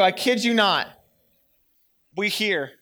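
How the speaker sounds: usual speaking voice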